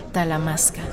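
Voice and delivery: usual speaking voice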